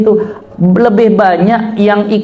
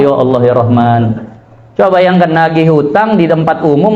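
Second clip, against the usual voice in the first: ordinary talk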